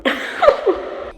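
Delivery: high, breathless laughing fit; burst of laughter. Retold or imitated. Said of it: "I burst out laughing".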